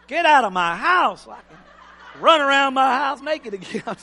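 loud, high-pitched comic order; exasperated parent shout. Acted. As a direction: play a fed-up parent booting the kids out. Acted parent ordering grown kids out.

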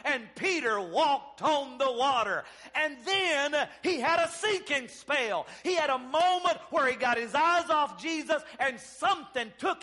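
driving, emphatic pulpit delivery; fervent preaching. Real, not acted. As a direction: preach it with rising conviction. Preaches at full force.

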